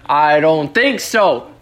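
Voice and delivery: usual speaking voice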